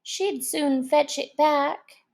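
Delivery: ordinary delivery, nothing marked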